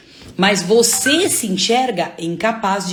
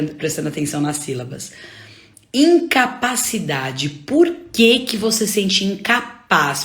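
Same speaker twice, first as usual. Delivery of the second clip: usual speaking voice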